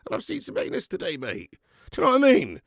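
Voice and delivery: high, loud, indignant tirade; exasperated rant. Real, not acted. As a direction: rail at it, voice pitched right up. Indignant rant at dairy advice.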